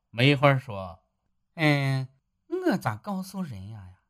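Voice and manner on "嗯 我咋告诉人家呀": lightly voiced wife, higher and softer, coaxing; wife cheers him up about a better life; encouraging wife. Wife answers his plea. (妻子声音，略高略轻，温和劝慰)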